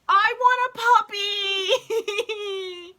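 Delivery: high, slow, tearful wail; half-sobbing through a laugh; crying laugh. Real, not acted. Fan sobbing over the episode, crying laugh.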